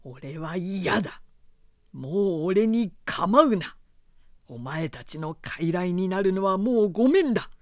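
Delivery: cold, forceful refusal, loud; cold but strong. Acted. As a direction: reject them coldly and with force. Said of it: "冷ややかな、しかし強い調子で".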